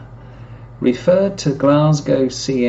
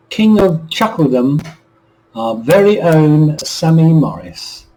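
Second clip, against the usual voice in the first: higher, louder showman announcement; grand announcer intro; 6 semitones higher, 6 dB louder than usual. Acted. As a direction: build up the star like a compere. Radio host introduces comedian.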